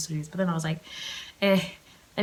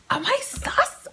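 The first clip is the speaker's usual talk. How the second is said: mock squeal; slower than usual. Self-questioning aloud.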